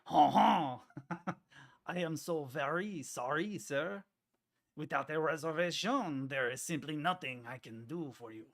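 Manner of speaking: exaggerated French waiter voice, laughing snootily; French accent. Streamer hamming a French maître d' in a French accent. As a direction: put on a hammy French accent for the fussy host. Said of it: "read that with a French accent".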